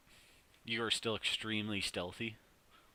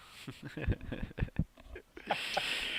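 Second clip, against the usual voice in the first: mocking laugh from inside; 7 semitones higher than usual. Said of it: "you hear laughing from the inside".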